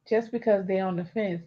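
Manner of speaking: ordinary delivery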